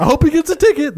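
high, sneering imitation; mock him in a high voice. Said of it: "It's like".

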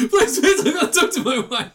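squeaky desperate begging; very high laughing plea. Retold or imitated.

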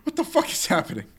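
high, incredulous mock outburst; baffled player. Gamer reacting to the baby scene, high mock disbelief. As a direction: blurt it like the plot just broke you. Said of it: "And it's like".

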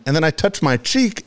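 ordinary delivery

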